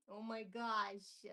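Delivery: ordinary talk, nothing marked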